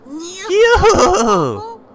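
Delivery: very high, loud sing-song call; a mascot's teasing farewell trill; gleeful yoo-hoo. Acted. Monokuma sings out goodbye to the students, very high. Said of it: "Monokuma said to us".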